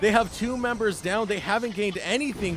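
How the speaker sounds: excited. Real, not acted. Caster calls Baron steal attempt.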